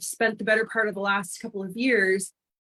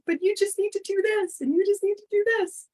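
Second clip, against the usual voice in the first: much higher, insistent urging voice; imitating her urging self; 12 semitones higher than usual. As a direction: push them like a helpful know-it-all, pitched way up. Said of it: "And I'm like".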